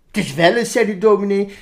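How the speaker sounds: put-on lively, sing-song reading of a children's poem. Reading the Veronica poem aloud for the interviewer, animated. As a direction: read it out like a storyteller to kids.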